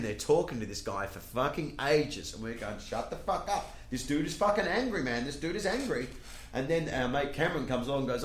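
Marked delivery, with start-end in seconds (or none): 2.8-6.1 high-pitched urgent aside, mildly acted; urgent warning. Friends warning Woody about an angry man, urgent warning. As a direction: warn him without raising the volume.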